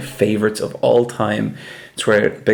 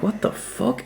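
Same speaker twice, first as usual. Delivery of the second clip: shocked; 10 semitones higher, 6 dB quieter, slower than usual. Retold or imitated. Reacting to review.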